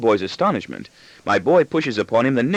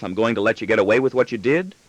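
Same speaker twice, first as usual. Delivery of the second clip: ordinary delivery, nothing marked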